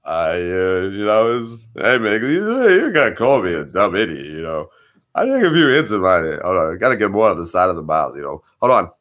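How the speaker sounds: lightly voiced slurred boxer; Rocky voice.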